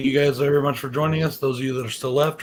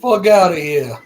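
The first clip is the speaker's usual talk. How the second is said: loud, high New Jersey tough-guy accent; 5 semitones higher, 9 dB louder than usual. Shown as a demonstration. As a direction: bark it like a New Jersey mobster. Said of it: "I don't know if I can do a Jersey accent".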